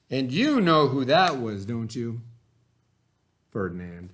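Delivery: put-on reading a story aloud to children; lively read-aloud for young listeners; expressive picture-book narration; storybook reading. A narrator's reading.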